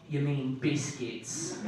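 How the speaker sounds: low, slow, soft British imitation; understated British drawl; British friend. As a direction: quietly correct them in a British accent. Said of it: "he's British".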